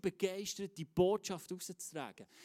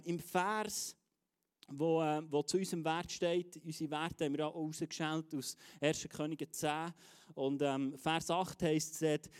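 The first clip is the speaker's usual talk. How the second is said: ordinary talk, nothing marked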